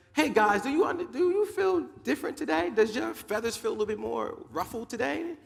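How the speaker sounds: high-pitched, eager questioning; excited chicken. Acted. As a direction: ask friends in a high, excited voice. Chicken asks friends eagerly.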